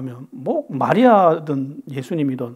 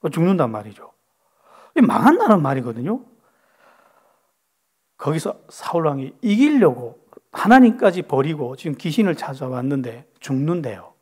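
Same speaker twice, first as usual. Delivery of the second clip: normal talking voice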